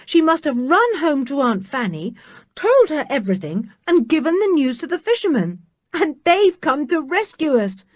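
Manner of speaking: high, quickening excitement; eager. Acted. Dick to the others, hopeful excitement. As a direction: rush out the good news.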